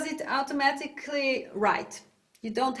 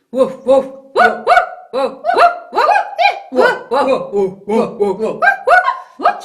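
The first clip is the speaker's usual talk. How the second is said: alternating dog barks; 9 semitones higher, 11 dB louder than usual. Shown as a demonstration. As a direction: switch between big dog and tiny dog. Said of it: "Jump in between".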